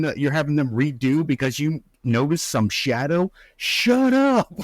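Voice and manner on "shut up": much higher, squeaked "Shut up." with "up" dropping; incredulous giggly "Shut up."; squealing mock shut up. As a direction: pitch it way up in disbelief. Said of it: "grabbing him by the neck going".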